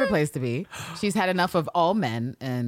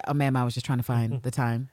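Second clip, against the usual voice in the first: lightly voiced lower apologetic man; meek guy; 7 semitones lower than usual. Guy answering the woman's outburst, lightly acted low meek reply. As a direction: back off innocently, in a dropped pitch. Guy backing off after rebuff.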